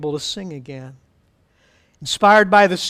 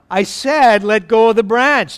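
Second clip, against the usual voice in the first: louder, insistent command; firm repeat; 3 semitones higher, 5 dB louder than usual. Acted. Lord telling Jack to let go of the branch, firm insistence. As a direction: repeat the order with more force. God repeats the instruction firmly.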